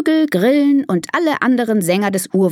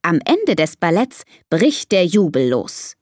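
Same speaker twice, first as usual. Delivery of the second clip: usual speaking voice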